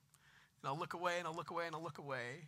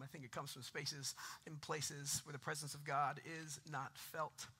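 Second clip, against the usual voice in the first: usual speaking voice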